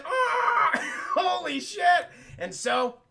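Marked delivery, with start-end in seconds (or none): 0.0-0.7 high, loud drawn-out scream; hammed-up screaming "ah"; mock scream. Imitates scream of the image. 1.1-2.1 high, loud, slow laughing exclamation; cracking up in disbelief; real laughter. Real, not acted. Cracking up after own scream.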